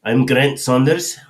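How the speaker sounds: put-on slow, high, terrible British accent; a hammed-up British "I'm Grant Saunders"; bad British accent. Host tries a British accent for a viewer, badly. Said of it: "Your British accent is terrible".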